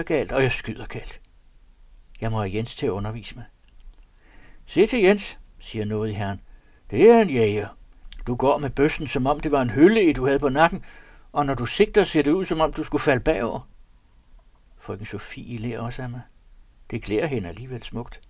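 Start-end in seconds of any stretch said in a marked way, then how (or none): 4.7-5.4 master's call. A narrator's reading. Lord scolds the narrator. 6.9-13.7 loud, mocking reproach from the lord; louder, mocking correction; scolding master. A narrator's reading. Lord mocks his gun handling.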